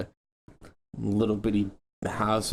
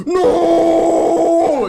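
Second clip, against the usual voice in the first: huge high yell; shouting; 9 dB louder than usual. Retold or imitated. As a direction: bellow NO at the attacker. Shouting at knife kid.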